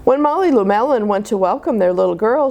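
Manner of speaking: plain reading voice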